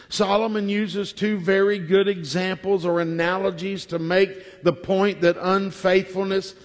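normal talking voice

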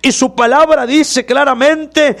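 the preacher's voice swelling at the climax; fervent preaching. Acted. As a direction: lift the congregation up with each phrase.

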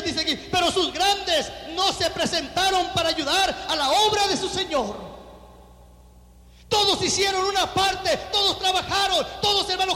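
loud, high preacher's proclamation; forceful scripture reading. Acted. Pastor reading Nehemiah three to the congregation, forceful proclamation. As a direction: proclaim the text to the whole church.

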